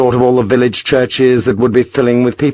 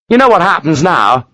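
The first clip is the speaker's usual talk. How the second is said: higher, pressing tone; angry question; 6 semitones higher than usual. Acted. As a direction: demand he sees it. Lawford to Sharp.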